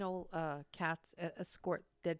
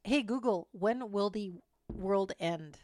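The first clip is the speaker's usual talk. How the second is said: slow, clear command to the speaker; repeating to voice assistant; 5 dB louder, slower than usual. Real, not acted. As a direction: spell it out for the machine.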